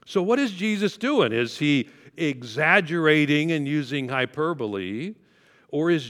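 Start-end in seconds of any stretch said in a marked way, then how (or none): none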